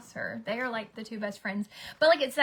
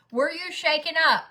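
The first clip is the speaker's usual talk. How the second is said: higher, louder pointed question to cue the son; pointed prompt; 7 semitones higher, 8 dB louder than usual. Retold or imitated. Mom cueing her son to say he was shaken, pointed and loud. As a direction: push the hint hard so he gets it.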